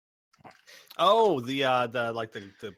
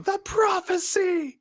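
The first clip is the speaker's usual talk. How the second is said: drawn-out, high-pitched loud scream; screaming at top of lungs; 8 dB louder, slower than usual. Acted. Counter guy proclaiming the prophecy to the crowd, screaming. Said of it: "screaming at the top of his lung".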